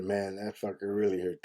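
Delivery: ordinary delivery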